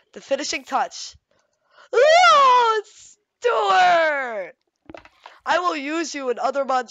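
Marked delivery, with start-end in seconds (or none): 1.9-4.5 high, loud gush that falls; drawn-out squeal of recognition; delighted cry. Real, not acted. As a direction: cry out with delight at a beloved pet. Greeting the hamster joyfully.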